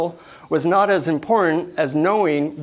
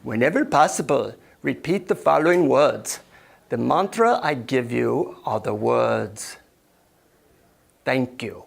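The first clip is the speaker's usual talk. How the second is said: put-on measured Indian-accented guru, slowing toward the end; 3 semitones lower than usual. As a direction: confide the mantra in an Indian accent. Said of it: "deep Indian accent".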